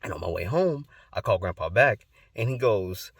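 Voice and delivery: ordinary delivery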